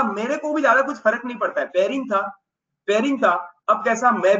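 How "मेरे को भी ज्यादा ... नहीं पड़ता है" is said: careless. Acted. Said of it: "और बोला".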